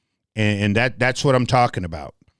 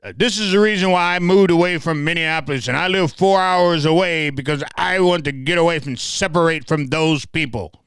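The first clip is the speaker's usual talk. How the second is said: higher-pitched quote of an indignant commenter; mocked online commenter; 6 semitones higher than usual. Quoting a man who moved away, mocked commenter. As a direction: read out the guy's complaint, pitched up. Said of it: "The guy said".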